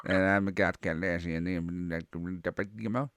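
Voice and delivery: low, softly mumbled impression; Boomhauer. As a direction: mumble it in a low, barely intelligible run. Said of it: "Boonhauer? And be like".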